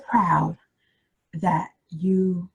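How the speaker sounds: stretched out, pausing before "that you"; measured letter line with a long pause; slow dictation. Shown as a demonstration. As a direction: dictate slowly, leaving the sentence open. Repeating the letter line for writers.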